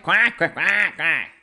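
high squawky duck quacks. Pretend. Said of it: "That, that means I'm ready".

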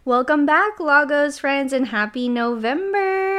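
cheerful greeting. Real, not acted. Podcast episode opening greeting.

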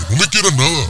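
high indignant pushback; annoyed rejection. Acted. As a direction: shove the affection away. Comic quarrel rejecting affection. (rechazo indignado y agudo)

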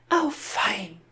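very high put-on girl's voice; little girl piping up in high pitch; Gretel's voice. A narrator's reading. Sister answering her brother's happy ending, high girl's voice. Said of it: "sagte Gretel".

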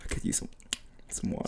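whispered, parched plea; thirsty guest. Acted. Thirsty guest hinting for a drink, mock parched whisper. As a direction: act parched, barely any voice. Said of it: "you're all thirsty".

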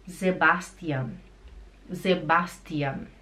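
Sebastian opening on Z, no nasal ão; German pronunciation. Shown as a demonstration. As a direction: say Sebastian as ze-BAS-tian. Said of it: "seu nome seria Sebastian". (Sebastian em alemão, com som de Z)

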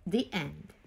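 one word at a time, with pauses; clipped single words, each one alone; staccato. Shown as a demonstration. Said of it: "build the story one word at the time".

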